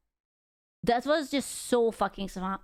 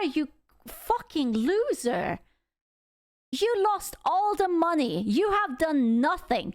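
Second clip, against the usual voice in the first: high-pitched, outraged tirade; indignant and rising high, stumbling over words; real anger; 5 semitones higher than usual. Real, not acted. Streamer berating the CEO over Sylvie, angry and high-pitched. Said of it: "I am so angry".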